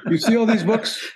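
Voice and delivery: bad German accent. Author quoting the German chef about his cookbooks, in a bad German accent. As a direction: put on a clumsy German accent for the chef's question. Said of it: "I have a terrible German accent".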